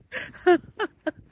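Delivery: short burst of laughter. Real, not acted. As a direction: laugh out loud at it. Son's complaint retold, laughing.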